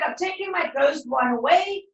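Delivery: normal talking voice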